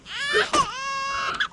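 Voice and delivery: high-pitched drawn gasp; shocked gasp. Pretend. Caregiver discovering a muddy child, shocked gasp. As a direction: gasp in mock horror.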